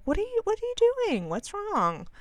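high, fast, fretful coo; a high, quick, worried coo at the dog; fussing high voice. Retold or imitated. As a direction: fret over him in a high, fast voice. Worried owner talking to the dog.

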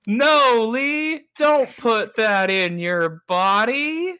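high, drawn-out mock pleading; mock alarm. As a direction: beg him in playful horror. Mock pleading before drinking Prime.

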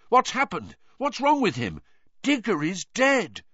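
high, anxious questions; frightened crowd voice; panicked onlooker. A narrator's reading.

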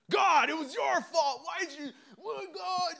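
high-pitched outburst, loudest at the start; angry blaming. Acted. Griever blaming God for the loss, angry outburst. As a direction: cry out in accusation, voice leaping up. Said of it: "we get angry".

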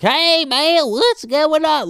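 squeaky put-on greeting; high mock greeting. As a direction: play up a bright fake hello. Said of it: "I'm like".

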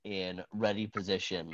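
dramatic scene-setting narration; game master narration. Acted. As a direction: set the scene like a storyteller. GM narrates the ice cave.

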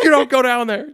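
high, loud mock scream; imitated screaming. Older sister warning brother off the stairs, mock screaming. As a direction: shriek it as a frightened warning. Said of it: "screaming at me".

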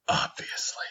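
soft breathy whisper; whispered aside. Shown as a demonstration. Host mutters a self-aware aside in whisper. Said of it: "that little whisper talk".

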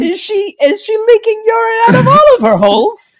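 high, loud huckster pitch; snake oil salesman. Acted. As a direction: sell the cure like a shouting quack. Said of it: "we're like snake oil salesmen right now".

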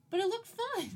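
put-on high-pitched innocent kid voice; imitating a child. Imagining the little girl excusing herself, high childlike voice. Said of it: "She'd just be like".

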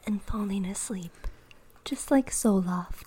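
whispered ad. Pretend. Sleep-aid ad whisper.

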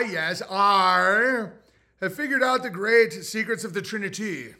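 high, loud grand bard proclaiming. Pretend. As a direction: declaim it like a minstrel. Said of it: "End of Bard voice".

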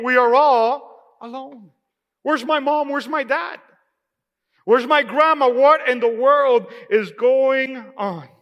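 put-on lifted, slowed voice of a lost child calling for family; imagined child asking where mom and dad are, lost and pleading; frightened child. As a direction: plead like a scared kid left with strangers. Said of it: "I imagine those kids saying".

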